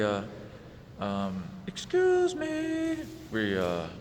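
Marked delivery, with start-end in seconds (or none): 1.7-3.0 drawn-out high falsetto; slow, very high mocking singsong; roommate impression. Roommate butting in on the storyteller, mocking high-pitched voice.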